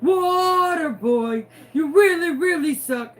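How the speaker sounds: put-on high, drawn-out call to the water boy. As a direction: holler "water boy" slowly, then heckle. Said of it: "I did also call the water boy".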